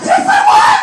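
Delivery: very high, loud squeal of pride; hammed-up, squealing confession; ecstatic shriek. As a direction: shriek it out, overjoyed. Ecstatic answer to friend's call.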